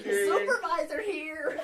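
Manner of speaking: high, drawn-out put-on voice; fake supervisor. Pretending somebody else took the supervisor call, high silly voice. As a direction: stretch it out loudly as a mock supervisor, laughing. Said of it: "I give it to somebody else".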